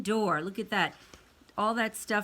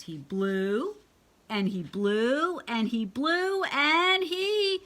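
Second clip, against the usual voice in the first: rising higher and louder with each blew; building suspense; 5 semitones higher, 4 dB louder, slower than usual. A narrator's reading. Reader building suspense before the balloon pops, rising. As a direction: build it up slowly toward the pop.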